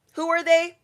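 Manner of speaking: high, loud mock bewilderment; baffled onlooker. Everybody watching a stranger get greeted, loudly baffled. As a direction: pipe up high, totally puzzled.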